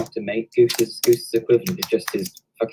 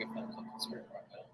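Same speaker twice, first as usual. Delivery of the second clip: whispering; 19 dB quieter than usual. Real, not acted. Whispering during a recording.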